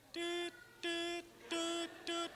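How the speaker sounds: high, soft beep-beep of a dead line; mimicked hang-up beeps. Mimicking the phone line going dead on callers. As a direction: chirp it like a phone cutting off. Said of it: "he would just hang up".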